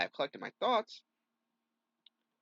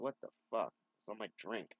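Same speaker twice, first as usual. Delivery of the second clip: normal talking voice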